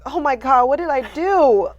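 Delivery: imitating her own panic, loud and high; panicked. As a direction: blurt it out in alarm. Retold panic before meeting boss.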